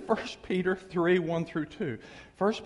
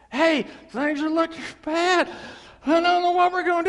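loud, higher hammed-up worry; mock panicked husband; 8 semitones higher, 7 dB louder than usual. Preacher voices a husband panicking out of the water. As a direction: play the fretting husband.

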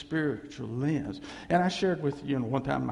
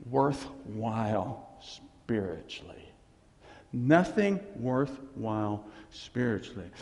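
slow emphasis; 5 semitones lower, slower than usual. Acted. Repeated sermon point, slowed.

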